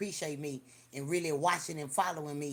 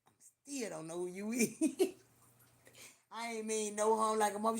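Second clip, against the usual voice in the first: ordinary talk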